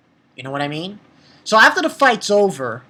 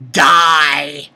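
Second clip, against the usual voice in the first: barked die; 5 semitones lower, 6 dB louder than usual. Real, not acted. Ranting at anime villain.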